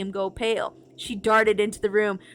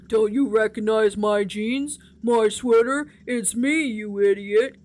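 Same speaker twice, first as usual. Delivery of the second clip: gruff voice; 4 dB louder, slower than usual. Acted. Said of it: "declared in a gruff voice".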